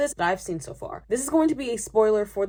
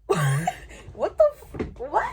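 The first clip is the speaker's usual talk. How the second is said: stunned disbelief; 4 semitones higher, much slower than usual. Retold or imitated. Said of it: "I'm like".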